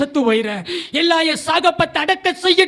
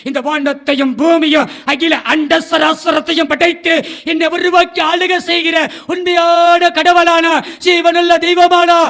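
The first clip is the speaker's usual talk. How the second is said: louder and louder preaching climax; full-force preaching; 8 dB louder than usual. Acted. Preacher crying out to the congregation, building to a shout. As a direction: build it into a thundering sermon cry.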